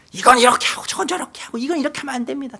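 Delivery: fast, high-pitched, hurried scolding; mock scolding. Chef scolding the owner, a mild mock rant. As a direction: rattle off orders like an exasperated chef. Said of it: "막 소리를 지르면서 혼을 내는데".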